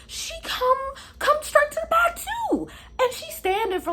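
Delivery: high, exasperated telling; high incredulous narration. Real, not acted. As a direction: pitch it up in disbelief. Exaggerated disbelief narration.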